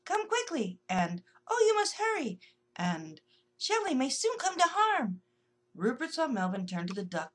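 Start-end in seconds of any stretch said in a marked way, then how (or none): 0.0-0.8 alarmed call. Acted. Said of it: "tiny voices shouting with alarm". 1.5-2.4 alarmed plea. Acted. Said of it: "tiny voices shouting with alarm". 3.6-5.2 alarmed warning. Acted. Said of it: "tiny voices shouting with alarm".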